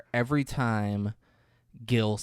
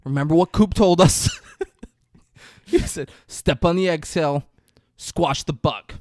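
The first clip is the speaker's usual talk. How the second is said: loud mock pep talk; 5 semitones higher, 7 dB louder than usual. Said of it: "And he's like".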